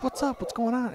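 high-pitched mock casual greeting; put-on high voice for clueless guys; guys in the video. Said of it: "these guys are like".

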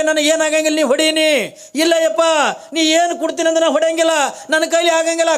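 pleading Kalavve. Acted. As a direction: beg him not to make her strike. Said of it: "ಅವಾಗಿಂದ ಕಾಳವೇ ಅಂತಾಳೆ".

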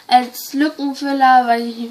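ordinary talk, nothing marked

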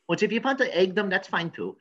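normal talking voice

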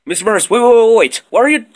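rising, high-pitched protest; alarmed pleading. Acted. Student begging the teacher to wait, rising alarm. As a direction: plead urgently to hold off.

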